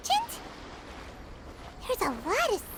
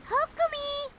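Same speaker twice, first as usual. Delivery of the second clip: higher-pitched eager call across the camp; 8 semitones higher than usual. Acted. Paimon calling Kokomi over to the merchant's goods. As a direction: shout her name to get her over here.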